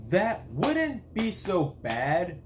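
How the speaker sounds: slow and deliberate, pitch lifted; slow drawn-out phrase. Shown as a demonstration. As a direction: stretch out wouldn't be so bad, lifting the pitch. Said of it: "repeats the same phrase".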